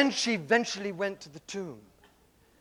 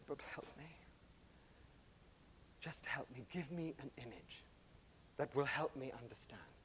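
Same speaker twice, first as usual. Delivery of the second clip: much softer, breathy tearful plea; weeping plea; 5 semitones lower, 22 dB quieter than usual. Retold or imitated. Ava weeping to the old man, a hushed tearful plea. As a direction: beg for help through tears, hushed. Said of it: "And she wept".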